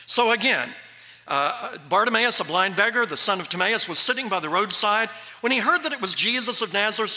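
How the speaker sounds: usual speaking voice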